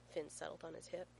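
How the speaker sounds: ordinary delivery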